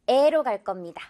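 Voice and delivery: ordinary talk, nothing marked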